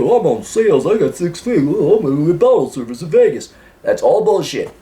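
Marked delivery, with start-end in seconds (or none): 0.0-3.5 boastful mock dude-bro voice. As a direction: play a bragging bro, pitched up. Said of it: "some dude bro entrepreneurship idea".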